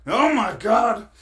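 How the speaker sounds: loud, high, drawn-out mock fright; startled scare. Driver woken by large police officer, startled mock fright. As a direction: jolt awake with an exaggerated yelp. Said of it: "he scared me".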